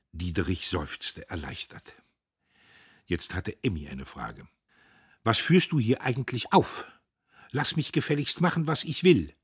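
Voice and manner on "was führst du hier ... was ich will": higher, louder, defiant; angry outburst. A narrator's reading. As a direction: lash back at him. Emmy lashes out at brother.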